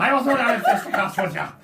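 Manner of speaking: high, loud laughing protest. Real, not acted. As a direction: crack up while denying it. Laughing reply.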